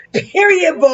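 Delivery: put-on young kids' slang. Shown as a demonstration. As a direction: nail the teen catchphrase with attitude. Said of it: "let me move my weed right and say".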